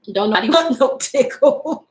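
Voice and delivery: laughing through the words; amused laughing punchline. Real, not acted. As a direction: giggle it out. Laughs during repeat.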